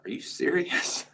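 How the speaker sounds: high, breathless whisper of disbelief; stunned disbelief. Retold or imitated. Told the sponsors want to do it, breathless disbelieving whisper. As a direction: gasp it out, can't believe it. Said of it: "And I'm like".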